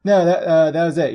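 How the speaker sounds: ordinary talk, nothing marked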